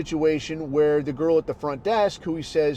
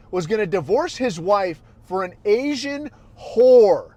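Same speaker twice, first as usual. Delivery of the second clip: normal talking voice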